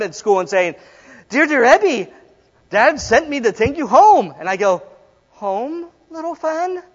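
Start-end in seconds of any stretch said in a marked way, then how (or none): 1.3-4.3 higher, theatrical sister's line; little sister role. Acted. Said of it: "Fan, his sister, is coming to him". 5.3-6.8 high, soft questioning; tentative line rehearsal. Acted. Practising his only line.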